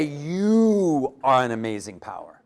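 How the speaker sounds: "You" drawn out long, whole line slow; slow line leaning hard on "You"; stress on You. Shown as a demonstration. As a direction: stretch out the word "You" as the correction. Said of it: "You're gonna say".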